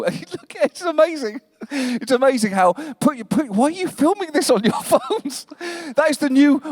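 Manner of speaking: high-pitched, breaking up with real laughter; laughing mid-sentence. Real, not acted. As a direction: try to talk through a real laugh. Breaks up at audience filming him.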